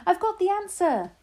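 very high, loud triumphant cry; excited shout. A narrator's reading. Child leaping with the solution, shouting excitedly. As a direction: announce your discovery at a shout. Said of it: "I shouted".

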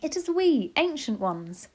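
put-on high, meek old witch. A narrator's reading. Ancient witch behind the door, meekly. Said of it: "said a meek voice".

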